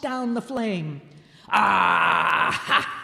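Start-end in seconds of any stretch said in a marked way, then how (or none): 1.5-3.0 loud gasping ah then breathy ha; a hot drink burning down, loud cry; goblin king's loud cry of delight. A narrator's reading. Goblin King after swallowing fire, loud exclamation. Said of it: "this warms one indeed".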